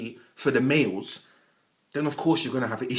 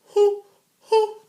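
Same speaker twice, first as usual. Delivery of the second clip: hoo hoo; 8 dB louder than usual. Real, not acted. Calming breaths, crying laugh.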